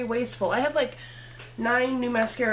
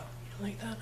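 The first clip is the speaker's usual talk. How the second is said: breathy whispered question to the camera; 12 dB quieter than usual. Real, not acted. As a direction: whisper it as if sharing a secret. Admiring the look, whispering.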